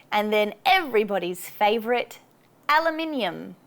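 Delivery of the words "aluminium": "aluminium" with a high AL at the front; first-syllable stress. Shown as a demonstration. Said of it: "emphasize the first syllable".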